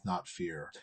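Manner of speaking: normal talking voice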